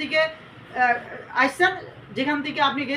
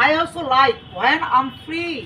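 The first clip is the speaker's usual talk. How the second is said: slow dictation; much slower than usual. Shown as a demonstration. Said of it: "teacher dictating a model sentence".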